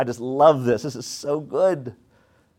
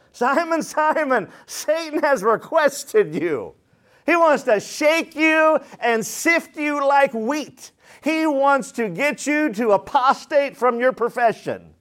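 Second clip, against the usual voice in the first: high, slow, stretched warning; raised preacher's voice, drawn out; impassioned preaching; 10 semitones higher, slower than usual. Real, not acted. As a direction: preach the warning with weight on each phrase. Preaches Jesus' warning to Simon.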